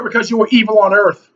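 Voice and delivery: ordinary talk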